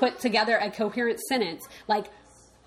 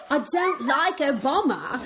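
retold activist; 6 semitones higher, slower than usual. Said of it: "And she was like".

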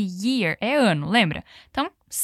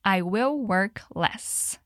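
slow model sentence; 3 semitones lower, 3 dB quieter, much slower than usual. Shown as a demonstration. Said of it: "repete comigo".

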